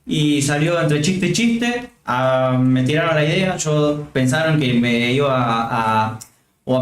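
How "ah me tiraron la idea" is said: normal talking voice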